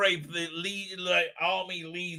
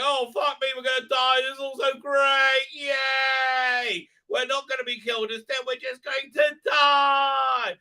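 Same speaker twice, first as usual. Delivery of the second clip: mock cheer; 7 semitones higher than usual. Elves on the boat cheering their own death, high mock shout. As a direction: shout it like doomed cheering fans. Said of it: "they're all like".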